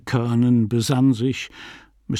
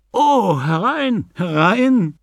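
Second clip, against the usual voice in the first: high, loud, drawn-out call; calling out welcome; 9 semitones higher, 5 dB louder, slower than usual. A narrator's reading. Host inviting Fogarty into the bedroom, calling out. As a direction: call the visitor in eagerly.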